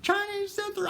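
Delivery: shrieky falsetto imitation of a woman's meltdown; mock crying scream. Thwarted buyer has a meltdown at the seller, mock screaming falsetto. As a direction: wail it very high without real volume. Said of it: "She starts crying and screaming".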